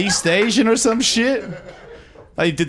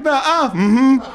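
mock refusal; 6 semitones higher, 4 dB louder, slower than usual. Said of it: "This guy's like".

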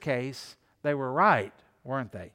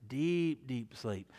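hushed; 3 semitones lower, 5 dB quieter, much slower than usual. Shown as a demonstration. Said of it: "fast asleep".

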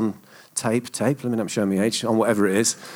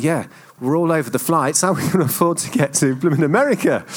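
higher, mock-indignant outburst; exasperated protest; 5 semitones higher, 4 dB louder than usual. Husband objecting to wife about paying for flights, exasperated. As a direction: protest with rising, comic disbelief.

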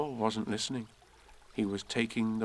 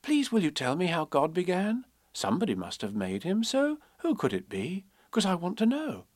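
put-on higher, earnest little girl; curious child; 7 semitones higher than usual. Elizabeth Ann asking her Nan, curious child. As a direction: plead to know, pitched up.

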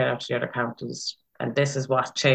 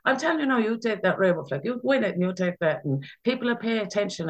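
much higher, insistent sister voice; imitating her sister; 8 semitones higher than usual. Late sister urging her to take the rainbow flag, imitated high and insistent. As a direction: mimic a sister laying down the law, pitched up.

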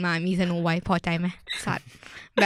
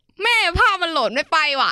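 much higher, innocent fib to mom; high fake excuse; 9 semitones higher, 5 dB louder than usual. Fibbing to mom by text, higher and innocent. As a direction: play dumb, pitched up. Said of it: "กูบอก".

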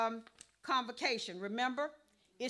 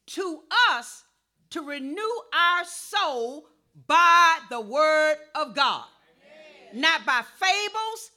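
loud, slow emphatic sermon delivery; full-force preaching; 8 dB louder, slower than usual. Real, not acted. Preacher urging the congregation to renew their souls, loud preaching. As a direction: drive each phrase home from the pulpit.

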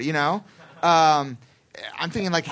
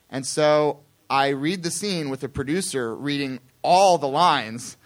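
ordinary talk, nothing marked

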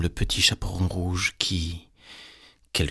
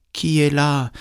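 calling grandmother; 7 semitones higher, 4 dB louder than usual. A narrator's reading. Grandmother in bed answering a knock, high calling voice. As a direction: call out high to whoever knocks.

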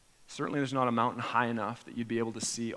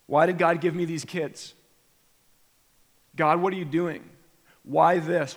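bitter accusing complaint; 3 semitones higher, 6 dB louder than usual. Retold or imitated. Believer voiced accusing God.

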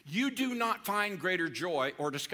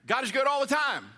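high, loud shouted church call; call and response shout; 7 semitones higher, 5 dB louder than usual. Shown as a demonstration. Pastor shouting the second phrase to the congregation, loud and high. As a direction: ring it out to the whole room. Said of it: "Then I'm gonna say".

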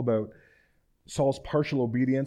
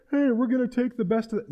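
high, light mock soldier; soldiers' voices; 11 semitones higher than usual. Soldiers telling each other about plunder, high-pitched mimicry.

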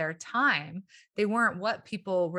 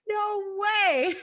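amazed; 12 semitones higher, 4 dB louder than usual. Retold or imitated. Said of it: "And I was like".

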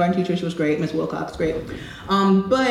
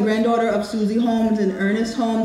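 ordinary talk, nothing marked

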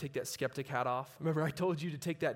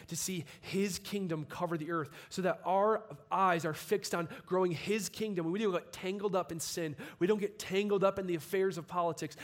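building, louder and higher sermon climax; preaching at full force; 5 semitones higher, 5 dB louder than usual. Acted. Urging the congregation to live for the kingdom, preaching full force. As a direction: drive it home, rising to a shout.

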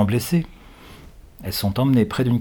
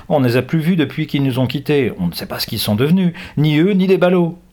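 put-on higher, loud girl's retort; teenage girl retort; 6 semitones higher, 4 dB louder than usual. A narrator's reading. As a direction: toss the question back at him.

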